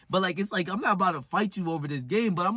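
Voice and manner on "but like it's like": ordinary talk, nothing marked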